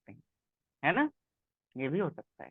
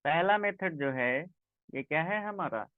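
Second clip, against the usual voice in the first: ordinary talk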